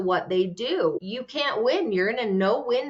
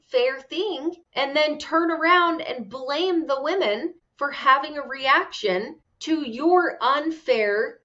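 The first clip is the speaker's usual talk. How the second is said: indignant rant; 7 semitones higher than usual. Real, not acted. Angry rant at Cody.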